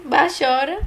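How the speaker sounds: normal talking voice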